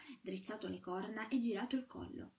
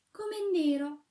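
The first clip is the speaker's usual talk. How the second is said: loud, higher gasp of dismay; dismayed exclamation; 8 semitones higher, 10 dB louder, slower than usual. A narrator's reading. Shepherdess at the stove door, loud high dismay. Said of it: "esclamò lei".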